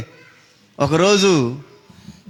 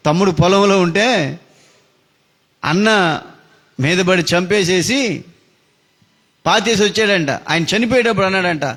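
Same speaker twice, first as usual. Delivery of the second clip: ordinary talk, nothing marked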